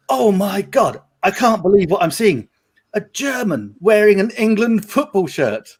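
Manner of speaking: high, excited announcer voice over the mic; mock DJ announcing to the whole bar. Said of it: "the D J bar owner was like, on the mic".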